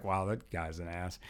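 low, soft mutter under the breath; muttered aside. Retold or imitated. Bystanders muttering to each other about him, a low quiet grumble. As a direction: grumble it quietly to the person beside you.

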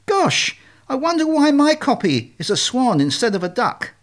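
high, innocent wondering; puzzled naive duckling. Acted. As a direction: muse in a high little duckling voice.

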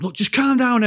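HR woman pleading. Retold or imitated. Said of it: "the H R woman's going".

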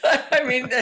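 high laughing words. Real, not acted. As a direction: laugh through it. Laughing at the scene.

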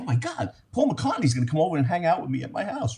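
excited gush. Retold or imitated. [name] thrilled that [name] is coming over, gushing. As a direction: retell him bursting with excitement. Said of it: "all excited".

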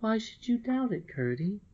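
a hint of a kind old lady asking; gentle questioning lady.

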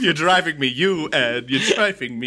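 high, loud hammed-up repeat; mock echo. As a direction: parrot the plea back mockingly. Mocking echo of the plea.

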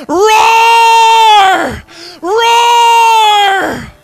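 full-throated high shouted roar. Acted. Pastor crying out to God, roaring. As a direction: let it rip like a lion. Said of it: "I want to roar for you".

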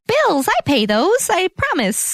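put-on high, slow little-kid voice; kid posing as adult. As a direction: play a child insisting on being grown-up. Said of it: "a little kid masquerading as an adult".

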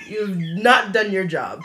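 ordinary delivery

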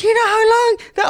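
imitating wife, very high; indignant wife. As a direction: squeal it like the upset wife. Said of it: "She goes".

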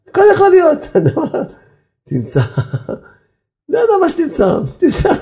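high voice breaking into laughs; real amused laughter. Real, not acted. Rabbi cracking up at his own absurd examples, laughing. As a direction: talk through a real giggle.

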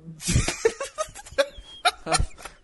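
high helpless laughing fit; can't stop, shrieking with laughter; hysterical laugh. Real, not acted. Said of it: "O [name] deu crise agora".

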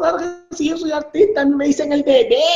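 put-on high, giggly childlike voice; mock baby-voiced singer bragging; imitating el bebé. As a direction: squeak it out like a laughing baby. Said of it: "se ríe como un bebé y todo".

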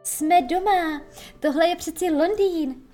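high, loud cry of delight; joyful calling out. A narrator's reading. Wendy spots London from the air, joyful calling. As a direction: call it out high and thrilled. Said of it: "udělala Wendy z radosti kotol ve vzduchu a volala".

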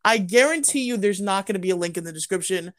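usual speaking voice

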